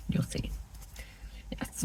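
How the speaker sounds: soft voiceless aside; whispered aside. Real, not acted. Crafter whispering an aside to viewers. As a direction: drop to a whisper.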